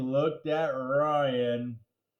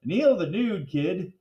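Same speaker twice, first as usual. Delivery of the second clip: ordinary delivery, nothing marked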